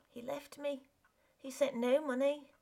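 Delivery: wife's pleading voice. Acted. Wife confesses to husband.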